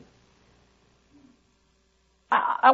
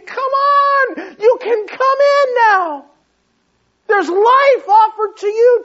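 much higher, louder pleading cry; pleading shout; 11 semitones higher, 8 dB louder than usual. Acted. Author of Hebrews pleading with readers, crying out loud. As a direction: beg them to come in, near crying. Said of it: "The author of Hebrews is pleading".

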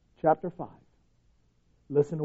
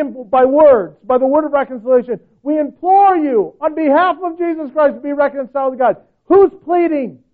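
full-force preaching; 8 semitones higher, 9 dB louder than usual. Acted. Pastor tells the church God pleads through them, loud preaching. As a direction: hammer home that God is pleading. Preacher drives home the verse.

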